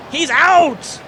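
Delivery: excited call, voice cracking high. Real, not acted. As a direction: voice breaks on the shout. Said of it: "Sorry about the voice crack there".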